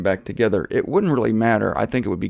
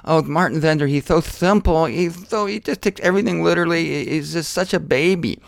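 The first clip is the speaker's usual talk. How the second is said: a lightly voiced scoffing critic, pitched up; mocking critic; 5 semitones higher than usual. To viewers, imitating critics who call him simple, mild mock scoff. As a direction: mimic a sneering skeptic, only half in voice. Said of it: "hear me talking like this and say".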